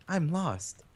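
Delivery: put-on high cute anime girl. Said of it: "in her anime voice".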